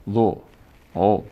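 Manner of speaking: ordinary talk, nothing marked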